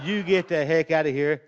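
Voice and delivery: put-on a little old lady shooing off an intruder, loud and slow; retold scolding shout. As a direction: boom it out like a fed-up old aunt. Aunt ordering stranger out.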